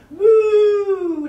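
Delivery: loud long moo; cow moo. Pretend. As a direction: moo up to the moon. Cow pose mooing.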